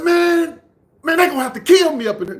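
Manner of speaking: put-on high, loud, fast kid's protest; heated mock yell; retold defiant outburst. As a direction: act out a brother refusing to back down.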